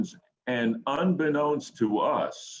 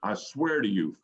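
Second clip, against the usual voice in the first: ordinary talk, nothing marked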